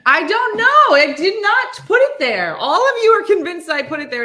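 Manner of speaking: high, loud indignant denial; protesting to family about the remote; defensive protest. Real, not acted. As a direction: insist you're innocent. Denying she hid the remote.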